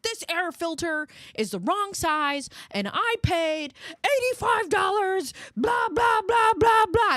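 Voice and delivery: high, loud whiny rant building; mock complaining. Wife imitating husband ranting about air filter, high whiny rant.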